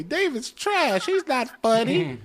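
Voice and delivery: high, drawn-out mimicry of a hater; mocking troll. Comedian imitates trolls who watch him every week, high mocking voice. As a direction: whine it out high, imitating a complainer. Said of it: "you gotta watch me and say".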